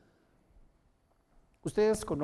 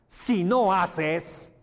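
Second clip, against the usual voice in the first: very slow, higher and louder, stretched out; heavy emphasis; 6 semitones higher, 7 dB louder, much slower than usual. Shown as a demonstration. Teacher stresses the warning about skipping inflections. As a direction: stretch each syllable hard. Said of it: "Así te van a escuchar".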